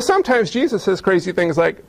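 ordinary delivery

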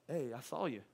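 low, soft, deadpan remark; understated neighbor. Retold or imitated. Neighbor to man seen running from bees, quietly deadpan. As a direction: play the neighbor quietly knowing. Acting out neighbor walking over.

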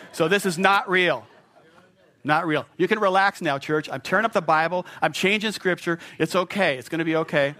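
calm the room down with energy; emphatic reassurance. Real, not acted. Reassuring shocked congregation after tearing Bible.

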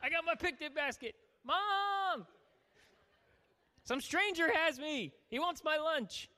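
put-on high-pitched whining child calling for Mom; little boy. Boy held up by a stranger calls his mom, mock child's whine. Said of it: "The boy's like".

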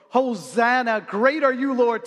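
a hint of crowd acclaim; crowd praising. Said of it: "changed their tune from".

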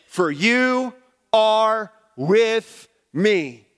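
each word of "For you are with me" drawn out slowly; stressed key phrase. Shown as a demonstration. Pastor stressing the key verse to his congregation, drawn out. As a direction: lean hard on "are with me", slow and weighty. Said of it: "The most significant phrase".

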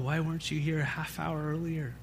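soft, subdued retelling of a grieving woman; hushed, understated lament; retold weeping mother. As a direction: quote her grief softly, without real crying. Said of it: "weeping and weeping and weeping".

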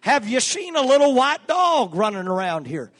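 urgent shout. Retold or imitated. Father shouting to the officer about the lost dog. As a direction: shout it out desperate to find the dog.

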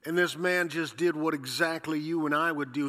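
ordinary talk

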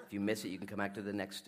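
fast talking. Real, not acted. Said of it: "say it as fast as I can".

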